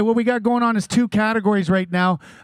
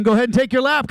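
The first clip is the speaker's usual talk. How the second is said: ordinary talk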